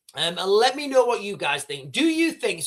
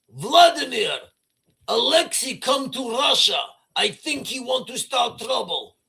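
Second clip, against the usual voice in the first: lightly hammed Russian accent, pitched up, bringing bad news; Russian aide; 5 semitones higher than usual. Aide phoning Vladimir about Alexei, in a hammed-up Russian accent. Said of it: "He's like".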